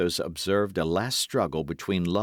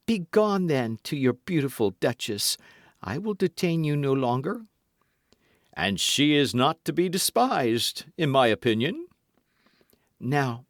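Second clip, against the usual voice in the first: lightly voiced swaggering musketeer, raised pitch; Porthos voiced; 8 semitones higher, 3 dB louder than usual. A narrator's reading. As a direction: play Porthos pleading with mock hurt. Quarrel continues.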